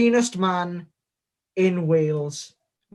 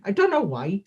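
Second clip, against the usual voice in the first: ordinary delivery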